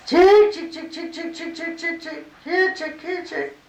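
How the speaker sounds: high sing-song call to chickens. Shown as a demonstration. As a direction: call the hens in, high and lilting. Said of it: "what were the different calls to chickens".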